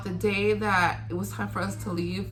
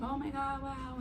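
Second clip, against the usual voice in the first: soft, higher-pitched, tender marvelling; in awe; 6 semitones higher, 11 dB quieter than usual. Retold or imitated. Mother admiring her newborn daughter, in hushed awe. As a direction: breathe it out softly, full of love. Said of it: "in love and in awe".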